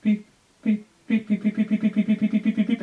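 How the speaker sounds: high, loud electronic beeping mimicked fast; heart monitor beeps. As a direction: beep out the monitor like a machine. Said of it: "and I hear".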